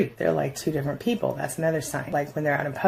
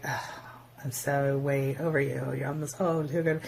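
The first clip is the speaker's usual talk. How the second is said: mock disgust; 6 dB quieter than usual. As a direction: sneer it down your nose, quietly. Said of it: "acting disgusted".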